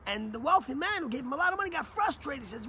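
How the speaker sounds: ordinary talk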